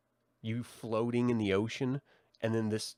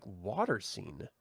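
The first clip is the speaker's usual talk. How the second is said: normal talking voice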